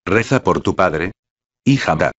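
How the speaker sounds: usual speaking voice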